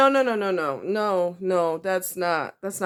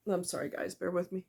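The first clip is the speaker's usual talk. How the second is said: much softer, holding back tears; apologizing to viewers while tears rise, soft and choked; choked-up apology; 9 dB quieter than usual. Real, not acted. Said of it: "I don't know why I'm so emotional".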